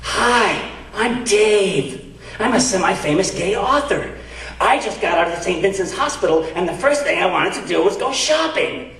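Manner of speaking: high, theatrical aside, meant to be overheard; stage whisper. Retold or imitated. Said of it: "says in a stage whis-, whisper".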